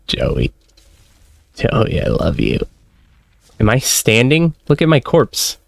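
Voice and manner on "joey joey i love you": low, mournful lament; hammed-up mourning, pitched low; mock grief. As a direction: grieve a pet in a dropped, softened voice. Mourning the dead game pet.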